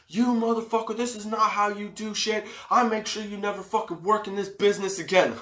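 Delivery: high-pitched rant at ordinary volume; mock angry yelling. Little performer chewing out the security guard, high mock yelling. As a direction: imitate a little guy's tirade, pitched well up. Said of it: "he's yelling and yelling and yelling".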